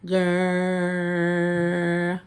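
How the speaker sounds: "Grr." with the tongue pulled back, held long; growled R sound. Shown as a demonstration. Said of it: "And pull your tongue back".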